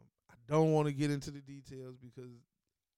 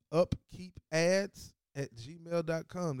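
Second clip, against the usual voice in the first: very slow, each part separate; spelled out slowly; 3 semitones higher, much slower than usual. Shown as a demonstration. Host reading a sponsor email to listeners, slow. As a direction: read the address slowly for listeners. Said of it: "That's upkeepads".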